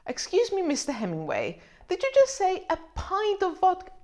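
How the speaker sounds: high, incredulous "a pint?!"; surprised interruption. Pretend. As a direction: cut in, shocked at the amount. Interrupting Hemingway mid-recipe.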